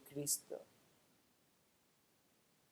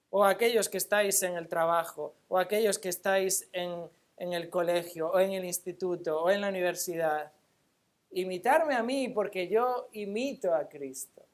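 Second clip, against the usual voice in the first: raised, challenging pulpit voice; emphatic preaching; 4 semitones higher, 6 dB louder than usual. Real, not acted. Preacher challenging believers at work and school, emphatic preaching. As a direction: press the challenge on the congregation.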